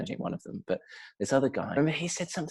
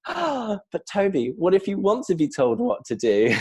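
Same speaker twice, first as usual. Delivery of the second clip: earnest graduate; 10 semitones higher, 6 dB louder than usual. Retold or imitated. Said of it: "He went".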